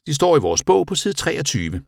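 high-pitched, lilting classroom voice; teacher giving instructions. A narrator's reading. Teacher figure addresses the class.

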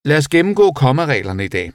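high, projected teacher voice; teacher addressing the class. A narrator's reading. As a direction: announce it loudly and high to a class.

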